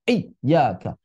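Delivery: ordinary talk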